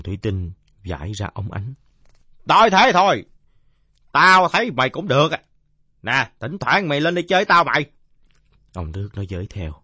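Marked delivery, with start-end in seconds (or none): 2.5-7.8 loud, high, blustery invitation; mollified drunkard. Acted. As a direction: grant grudging approval at full volume. (giọng ông say oang oang, xuề xòa)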